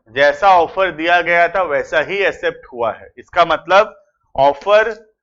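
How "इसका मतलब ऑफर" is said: ordinary delivery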